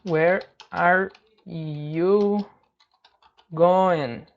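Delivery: very slow, one word at a time; careful full form. Shown as a demonstration. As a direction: spell out the question slowly, pausing between words. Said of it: "o correto seria isso daqui". (frase completa, bem devagar e pausada)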